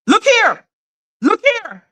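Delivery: high, mocking "look over there" voice; mock deflecting voice. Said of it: "so you're like".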